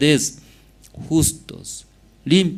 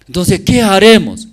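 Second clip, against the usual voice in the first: rising, louder preacher's call; loud question; 8 semitones higher, 7 dB louder than usual. Real, not acted. Preacher challenging the congregation, loud ringing question. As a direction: throw the question out to the church.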